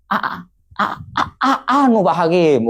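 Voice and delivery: stuck on the first sound, then blurting; mock stammer. Tongue-tied litigant answering the judge, hammed-up stammer. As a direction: stutter haltingly before getting the words out. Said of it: "Lah kare kan gagap yo gagap".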